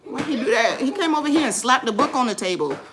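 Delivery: loud, high-pitched indignant complaint; startled protest. Real, not acted. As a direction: protest it loudly, still startled. Protests after being startled.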